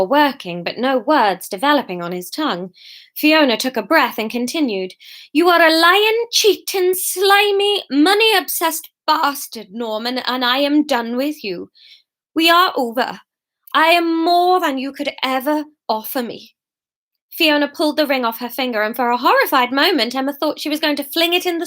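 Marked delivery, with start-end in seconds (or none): 5.3-16.6 insults pitched high, then firmly final; angry break-up tirade. Acted. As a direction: denounce him, each insult hit hard. Fiona dumps Norman angrily.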